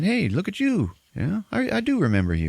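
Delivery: high, mocking greeting; hammed-up sarcastic greeting; mock cocky Pratt. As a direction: sing it up high with fake cheer.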